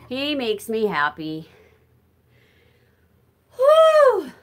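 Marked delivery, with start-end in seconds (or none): 3.6-4.3 high, loud whoop of exertion; big winded whew. Real, not acted. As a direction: let out a high-pitched whew. Winded while walking and talking.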